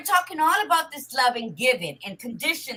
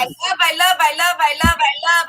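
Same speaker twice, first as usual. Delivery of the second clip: mock gushing; 4 semitones higher, 7 dB louder than usual. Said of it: "So many people wanna say".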